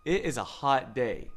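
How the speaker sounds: usual speaking voice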